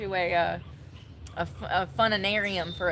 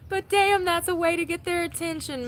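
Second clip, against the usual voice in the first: amazed; 9 semitones higher, 4 dB louder than usual. Real, not acted. Excited praise of the teacher.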